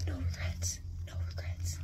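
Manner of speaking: hushed ASMR whisper. Real, not acted. To the camera, a secret ASMR whisper. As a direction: breathe the words, no voice. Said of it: "A S M R".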